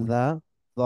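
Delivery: ordinary delivery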